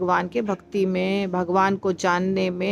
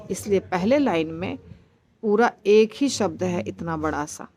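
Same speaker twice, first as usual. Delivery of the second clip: usual speaking voice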